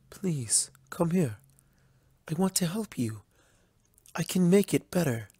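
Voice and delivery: gentle, soft coaxing voice; soft calling. Acted. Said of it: "she called out softly".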